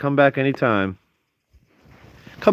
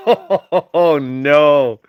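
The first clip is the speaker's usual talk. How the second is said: laughing; 2 semitones higher, 3 dB louder, much slower than usual. Real, not acted. Hearing the weed fell in water, laughing in dismay.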